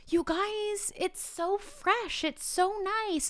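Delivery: mock sweet teacher. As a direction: coo it like a sweetly insisting teacher. Teacher defends her air freshener.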